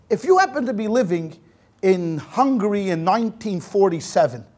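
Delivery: ordinary talk, nothing marked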